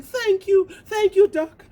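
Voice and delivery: high, loud joyful thanks; overjoyed thanks. Acted. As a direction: shout thanks running up. Tortoise overjoyed.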